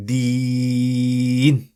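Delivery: Deen with a long drawn ee; stretched deen. Shown as a demonstration. Teacher shows stretched Deen, drawn-out ee. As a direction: hold the ee in Deen. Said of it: "I stretched it".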